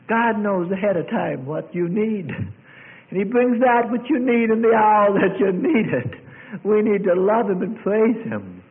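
high, loud sermon cry; impassioned preacher's shout; full-force preaching. Real, not acted. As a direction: proclaim it to the whole congregation.

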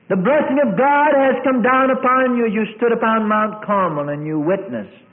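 high, loud sermon cry; impassioned preacher's shout; full-force preaching. Real, not acted. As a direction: proclaim it to the whole congregation.

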